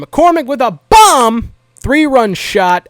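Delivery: loud, high, drawn-out punch on "bomb"; triumphant highlight call; excited home-run call. Real, not acted. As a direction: belt out the big hit, stretching the key words. Recapping a big home run.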